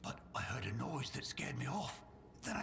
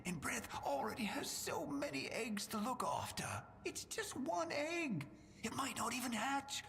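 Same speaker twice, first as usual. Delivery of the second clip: pleading; 10 semitones higher than usual. Acted. NPC begs to keep egg.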